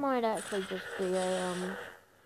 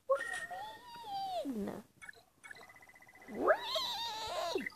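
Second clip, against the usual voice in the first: very high, slow, squeaky; squeaky disbelief; 7 dB quieter, much slower than usual. Real, not acted. Disbelief at low level.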